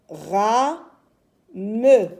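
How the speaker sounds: "Ra, me" as two separate beats; syllable by syllable. Shown as a demonstration. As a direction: sound out "Ra, me" in two pieces. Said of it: "J'ai deux syllabes".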